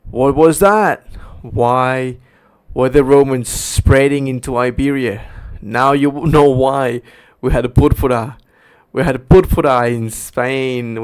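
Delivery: loud, forceful proclamation; impassioned preaching. Real, not acted. Preacher revealing purpura in Spain, loud insistent proclamation. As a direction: hammer each phrase out loudly. Preacher driving home a revelation.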